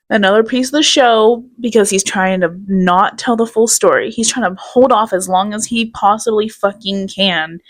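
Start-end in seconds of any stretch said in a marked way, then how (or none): none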